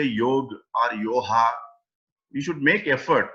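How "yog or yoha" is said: yog with no final vowel, yoha with h; two mispronunciations. Shown as a demonstration. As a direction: say "yog" as one syllable, then "yoha" like poha. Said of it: "we should try and not say". ("yog" ending on g, "yoha" with h for g)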